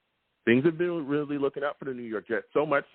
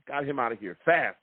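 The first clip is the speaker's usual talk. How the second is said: ordinary talk, nothing marked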